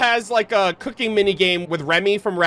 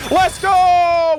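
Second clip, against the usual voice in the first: loud, much higher victory yell; triumphant shout; 11 semitones higher, 6 dB louder than usual. Real, not acted. Streamer nails the flavor balance, triumphant shout. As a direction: shout the win out to chat. Winning the cooking minigame.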